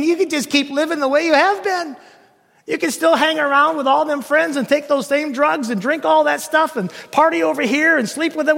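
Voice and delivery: high-pitched, run-on mocking assurance; mock easy sales pitch. Preacher parodies what people are told, high mock assurance. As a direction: rattle off the permissions high and mockingly.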